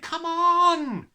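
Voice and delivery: very loud high call; a girl shouting to hurry. A narrator's reading. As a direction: yank her along with your voice. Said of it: "she shouted".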